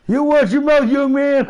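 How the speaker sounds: put-on high, loud scolding old-man voice; hammed-up elderly reprimand; grandpa scolding. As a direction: wag your finger like a cranky grandpa.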